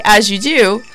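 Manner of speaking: usual speaking voice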